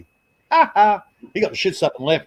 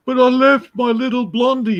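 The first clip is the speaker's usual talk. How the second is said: high, lightly whining imitation; whiny mate; 9 semitones higher, 3 dB louder than usual. Mike, walking away from the club, high mock whine. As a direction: pine for the girl you lost.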